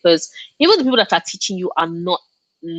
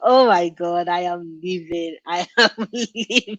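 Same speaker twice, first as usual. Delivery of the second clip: giggling through the words, slow; laughing through words. Real, not acted. Laughing through it, reacting to a chat comment. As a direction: laugh as you say it.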